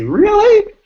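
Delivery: squeaky, sky-high and loud; amazed. Retold or imitated. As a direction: imitate someone floored by the news. People amazed on hearing author.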